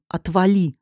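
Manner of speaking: ordinary delivery, nothing marked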